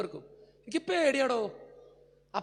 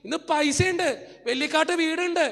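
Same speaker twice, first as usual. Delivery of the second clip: rising pulpit fervor; fiery preaching; 2 semitones higher, 5 dB louder than usual. Acted. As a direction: preach it to full force. Preaching climax.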